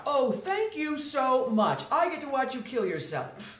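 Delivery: heavy sarcasm. Acted. Officer thanks the jumper with heavy sarcasm, slow and mock-grateful. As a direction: drip fake gratitude.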